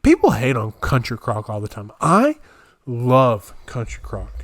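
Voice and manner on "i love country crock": I love punched out, love drawn slowly; stress on love. Real, not acted. Defending the butter nobody likes, with a big stressed I.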